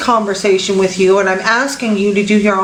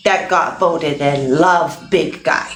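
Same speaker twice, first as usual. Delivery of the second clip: caveman voice. Acted. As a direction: talk like a simple caveman. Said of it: "This caveman voice is trend".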